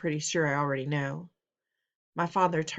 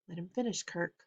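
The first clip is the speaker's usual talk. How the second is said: quiet firm mother; 7 dB quieter than usual. Acted. Mother calms father.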